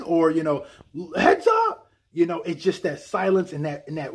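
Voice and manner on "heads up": high, laughing mock shout; mock yell. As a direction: yell it playfully. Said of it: "when he yells that".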